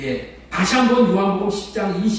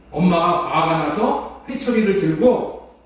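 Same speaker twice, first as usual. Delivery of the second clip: ordinary delivery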